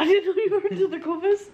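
high, fast, laughing protest. Real, not acted. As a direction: blurt it out while laughing.

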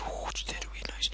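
very soft, quick whisper. Real, not acted. Gamer whispering after hearing a cave noise. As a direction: breathe it fearfully, fast.

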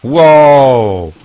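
loud, high drawn-out wow; amazed shout. Real, not acted. Streamer amazed by something in the game, loud drawn-out exclamation. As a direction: let out a big astonished wow.

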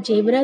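ज़ेब्रा mispronounced as "jebraa"; j sound in ज़ेब्रा. Shown as a demonstration. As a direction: start ज़ेब्रा with a j. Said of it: "तो नहीं पढ़ सकते".

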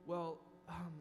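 mild sheepish excuse-making; gossiper's inner voice. Pretend. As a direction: talk yourself into joining the gossip, quietly. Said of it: "we think".

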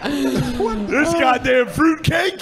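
slow, drawn-out laughing shout; mock outrage. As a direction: drag it out, half laughing.

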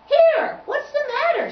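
high, loud little piccolo voice; piccolo character. Acted. Piccolo asking Tubby what's wrong, high piping voice.

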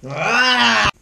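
sharp loud cry of frustration; frustrated yell. Real, not acted. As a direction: let out a sudden loud ah. Struggling to open candy.

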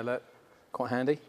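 ordinary talk

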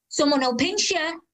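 high-pitched shouted warning; high carrying call without much extra volume; shouting. Acted. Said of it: "the woman shouted again".

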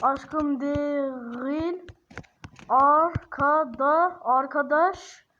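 very slow, drawn-out syllables; slow correction. Real, not acted. Correcting himself to viewers, spelling it out very slowly. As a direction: stretch every syllable out carefully. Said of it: "yanlış anlamayın ha".

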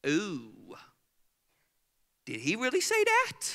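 mock gasp. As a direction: play a shocked listener. Mock congregation reaction.

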